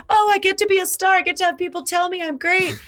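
high, quick gushing delight; starstruck little self. Retold or imitated. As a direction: gush like a thrilled child star. Voicing her delighted child-star self.

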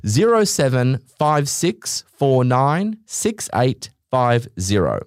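slow, deliberate digit by digit; reading a number to listeners, slowly. Real, not acted. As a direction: spell the phone number out carefully.

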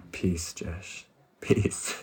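soft whispered peace, fading to breath; soothing whisper. Retold or imitated. The app's Jesus calming a fried brain in a whisper. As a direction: breathe it out gently. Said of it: "it says".

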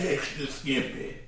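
put-on slow creaky old man. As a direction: drag it out like a grandpa. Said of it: "I said it like an old man".

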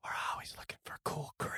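quick hushed golem rasp; raspy whisper. Acted. Golem answering the girl who asks to join, raspy whisper. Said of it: "in the same raspy whisper".

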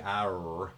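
"R" drawn out slowly as ah-er; British person attempting R. British person proving they can say it, drawn-out "R". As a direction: stretch "R" into a laboured ah-er. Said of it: "British accent person to say the letter R".

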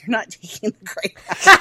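parents' retort broken by a laugh; laughing while quoting her parents' refusal. Retold or imitated. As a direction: giggle through the parents' line.

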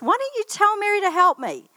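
high, whiny mock complaint; imitated cross protest, high-pitched. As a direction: play an aggrieved sister demanding help, pitched high. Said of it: "Martha was getting cross with Mary".

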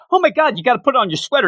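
high, fast, fussing mother; fussing mom. Retold or imitated. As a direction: fuss over him like an anxious mom. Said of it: "fussing mom".

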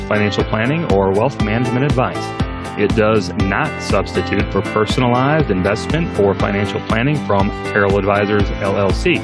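formal disclaimer read over music; fast legal disclaimer. Acted. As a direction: read the legal fine print evenly.

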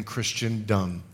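"Christendom" low, with its ending sounded out as "dumb"; stress on last syllable. Shown as a demonstration. Preacher derides false theology within "Christendom", stressing the final syllable. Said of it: "I emphasize the last syllable".

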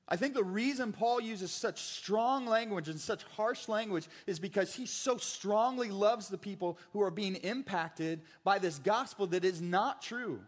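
usual speaking voice

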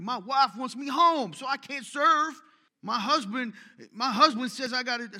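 loud, high, slow mock whine; whiny excuse. Preacher mocking members who hide behind their family, high whiny wail. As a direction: wail the excuse like a put-upon spouse. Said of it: "use the excuse of your family".

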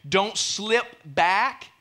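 Don't slip back, drawn out slowly; solemn slow caution; slow warning. Shown as a demonstration. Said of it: "warning repeated slowly".

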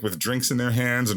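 ordinary delivery

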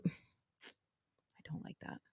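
hushed slow aside whisper. Real, not acted. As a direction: whisper it under your breath. Aside muttered to co-host.

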